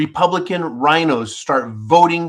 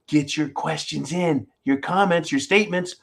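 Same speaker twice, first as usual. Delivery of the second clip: ordinary talk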